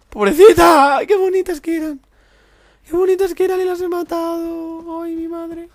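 very high hammed-up wailing pity; mock falsetto lament. Gamer mourning the birds he killed in a very high mock wail. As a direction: wail like a tearful old lady.

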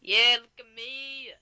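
loud, drawn-out daredevil holler; playful, stretched-out battle whoop; whooping show-off. Pretend. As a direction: holler it slowly, showing off. Showing off in the game.

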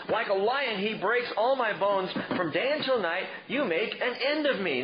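hammed-up self-pity; whining tantrum. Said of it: "he was throwing a tantrum".

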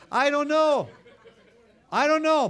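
high, loud laughing exclamation; laughing at his own story. Real, not acted. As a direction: laugh it out in delight. Laughing at chili dog story.

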